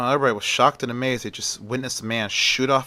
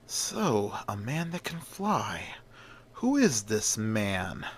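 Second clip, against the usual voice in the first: hushed musing; 8 dB quieter, slower than usual. Acted. Lex Luthor watching the rescue video, quiet intrigued murmur. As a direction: muse quietly to yourself, intrigued.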